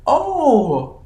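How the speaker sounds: put-on high, sing-song teacher brushing a kid off; humoring teacher. Kindergarten teacher to a kid, high and humoring. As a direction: humor the little kid with a laugh. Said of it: "and the teacher's like".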